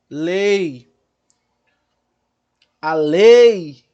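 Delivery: "Lei" loud with the E held long; drawn-out "Lei" as a vowel demo; sustained E. Shown as a demonstration. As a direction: stretch the E of "lei", gliding late into I. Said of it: "qual é a vogal que se sustenta mais".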